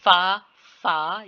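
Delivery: "फा" with a drawn-out vowel; long syllable. Shown as a demonstration. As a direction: hold each "फा" long, separately. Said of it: "ये long है". ("फा" को लंबा खींचकर)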